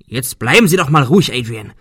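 irritated outburst, dropping at the end; scolding. A narrator's reading. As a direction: scold someone who is panicking. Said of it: "schimpfte Arthur".

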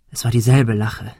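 ordinary delivery, nothing marked

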